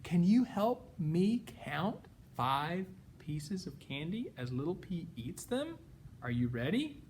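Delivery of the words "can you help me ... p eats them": plain reading voice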